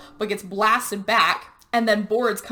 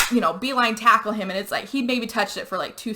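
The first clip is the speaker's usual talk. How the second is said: ordinary delivery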